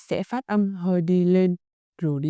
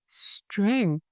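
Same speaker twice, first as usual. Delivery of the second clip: ordinary talk, nothing marked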